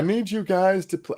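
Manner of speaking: high-pitched, badly faked Aussie accent; Barney's accent. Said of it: "I can't do his accent".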